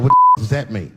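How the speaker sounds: loud, offended snap; angry retort. Retold or imitated. Friend taking offense on the phone, loud snap. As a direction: bark it back, offended. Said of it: "He's like".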